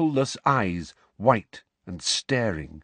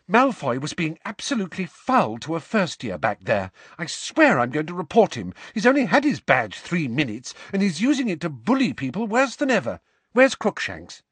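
put-on higher, fast indignant girl voice; indignant Hermione; 7 semitones higher than usual. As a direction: voice Hermione, outraged and rushing. Hermione, indignant, just panted in.

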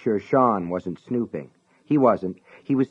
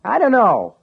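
irritated; 12 semitones higher, 7 dB louder than usual. Acted. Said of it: "sounded irritated".

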